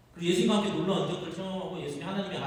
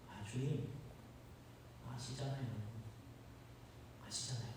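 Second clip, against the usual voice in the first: much softer, guilty Peter pleading; ashamed hush; 6 semitones lower, 17 dB quieter than usual. Acted. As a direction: barely voice it, head down in shame.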